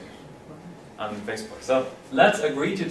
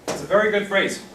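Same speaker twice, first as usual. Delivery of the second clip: usual speaking voice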